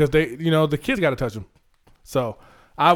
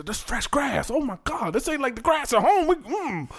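high, thrilled little animal voice; excited animal voice; 8 semitones higher, 5 dB quieter, slower than usual. Retold or imitated. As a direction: gush like an animal set loose. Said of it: "like they had to".

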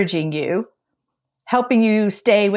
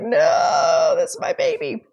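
put-on whiny big baby; slower than usual. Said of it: "I'm big baby".

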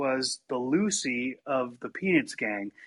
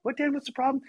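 annoyed sister; 10 semitones higher than usual. Retold or imitated. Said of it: "She's like".

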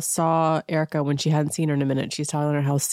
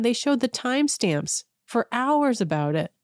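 normal talking voice

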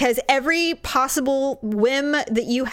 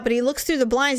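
normal talking voice

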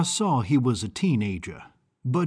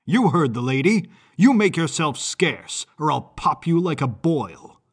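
louder, menacing warning; loud threat; 3 semitones higher, 5 dB louder than usual. A narrator's reading. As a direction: threaten him with force. Strongman threatening the boy.

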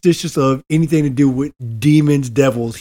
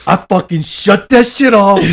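retold panicked outburst; 5 semitones higher, 5 dB louder than usual. Retold panicked gamer quitting.

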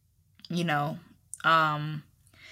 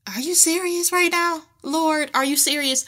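high, incredulous "are you serious"; stunned disbelief; 8 semitones higher than usual. Retold or imitated. A wife asking God in disbelief, high and incredulous. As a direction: ask it like you can't believe what you just heard.

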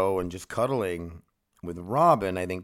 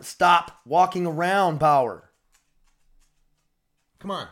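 loud, high, slow yell at the dog; 10 semitones higher, 9 dB louder, slower than usual. Real, not acted. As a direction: order the dog to stop pacing. Yelling at dog.

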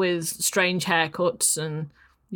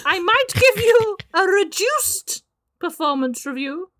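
high, busy witch voice; witchy voice; 9 semitones higher than usual. Acted. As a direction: hurry them along as a witch.

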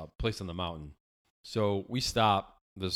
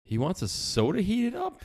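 puzzled inner thought; 12 semitones higher than usual. Retold or imitated. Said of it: "I'm thinking to myself".

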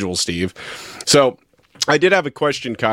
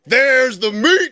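high, drawn-out catchphrase impression; Mister James's catchphrase; 12 semitones higher, much slower than usual. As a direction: stretch it way out, pitched high. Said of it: "what's the line?".

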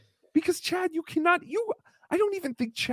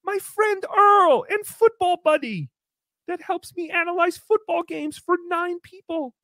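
mock gushing thanks; 7 semitones higher than usual. Mock grateful Chad speech.

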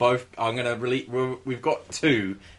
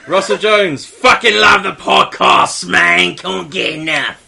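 loud, high tough-guy read; hard-man voice; 5 semitones higher, 10 dB louder than usual. Retold or imitated. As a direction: put on a tough bloke voice. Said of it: "What's he sound like, Ray Winston?".

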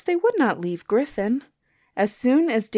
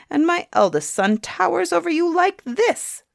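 a hint of a boastful old hag, voice climbing higher; lightly voiced crone crying out, pitch jumping up; mild proud cry from the old woman; 9 semitones higher than usual. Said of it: "cried the old woman".

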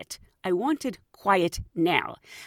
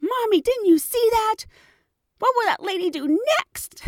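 put-on much higher, louder child voice; alarmed kid; 5 dB louder than usual. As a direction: tattle to mom in shock. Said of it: "coming to the stop at".

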